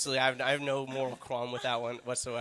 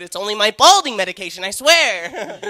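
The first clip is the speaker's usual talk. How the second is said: high, loud mock-desperate protest; friend's shouting voice; 7 semitones higher, 13 dB louder than usual. Friend pleading with a woman about his medication, high desperate shout. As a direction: yell it as a frantic, hammed-up plea. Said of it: "That's how my friend talks".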